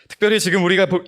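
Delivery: ordinary delivery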